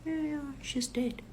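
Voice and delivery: high, soft, casual confirmation; calm daughter. Retold or imitated. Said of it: "My daughter is calm".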